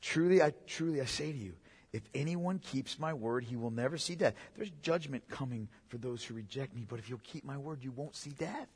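low, very soft preaching; solemn invitation. Acted. Jesus offering life to those who reject him, solemn and hushed.

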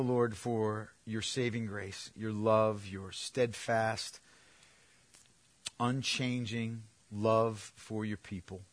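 soft, slow, hushed prayer voice; quiet prayer. Real, not acted. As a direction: pray tenderly on behalf of everyone. Leads congregation in prayer.

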